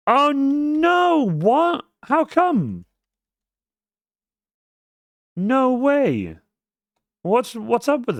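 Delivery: high-pitched wail of disbelief; dismayed disbelief. Real, not acted. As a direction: protest in a high, drawn-out whine. Command fails on him mid-stream.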